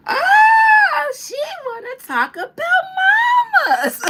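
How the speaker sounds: high, loud, laughing shout. Retold or imitated. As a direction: shriek it through laughter like the movie.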